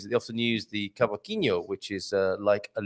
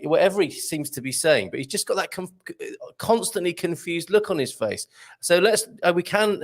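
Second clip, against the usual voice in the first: ordinary talk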